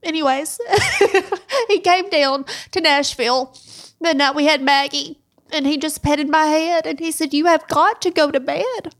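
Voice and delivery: real tearful retelling, almost crying; holding back tears. Real, not acted. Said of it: "I'll try my best to say it without crying".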